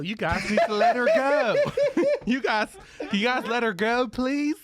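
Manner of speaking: high, hammed-up camp American accent. Actor negotiating his wife's release, camp American accent. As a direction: plead feebly in camp Southern American. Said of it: "We're looking for American accent".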